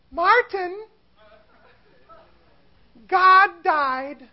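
high-pitched mock wife voice; Katie. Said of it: "Martin Luther or his wife, Katie".

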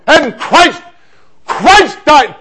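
high, loud open-air proclamation; street preaching. Retold or imitated. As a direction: re-enact preaching at full force to a street crowd. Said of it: "I'm on this corner preaching away".

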